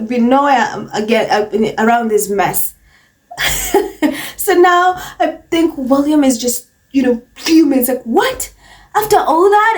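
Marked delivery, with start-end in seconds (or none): 3.3-4.3 high, squealing laugh that breaks out of her; real high-pitched giggle fit; burst of laughter. Real, not acted. 8.0-9.8 higher, mock-indignant disbelief; hammed-up shocked royal; mock outrage. Mocking William's shocked reaction.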